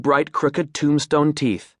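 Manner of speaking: ordinary delivery, nothing marked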